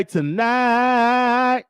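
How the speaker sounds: TONIGHT stretched very long on the second syllable; drawn-out emphasis. Real, not acted. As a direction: hold the vowel of tonight for hype. Hypes the late-night show.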